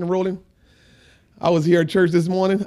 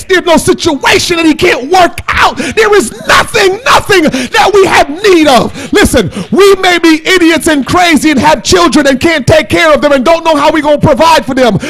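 raised, shouted sermon delivery; full-force preaching; 10 semitones higher, 10 dB louder than usual. Real, not acted. Preacher assures the congregation God can fix anything, full-force preaching. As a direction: drive each phrase out loud and high.

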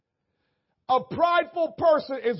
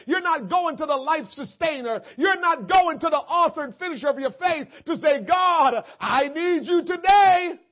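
louder and higher, building to a cry; rising preaching; 5 semitones higher, 6 dB louder than usual. Real, not acted. Preacher crying out to the congregation, building up. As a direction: build it up to the plea.

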